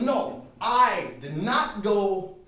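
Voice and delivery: loud, high, slow protest; indignant shout. Acted. Billy arguing with the teller over fake money, indignant shouting. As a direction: shout each word in mock outrage. Kid's outraged punchline to the teller.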